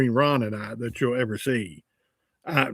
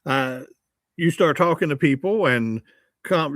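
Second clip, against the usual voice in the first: normal talking voice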